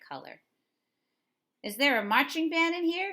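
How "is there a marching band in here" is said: loud, much higher teasing call across the shop. A narrator's reading. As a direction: call out to the girl stomping in. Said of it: "Henry called".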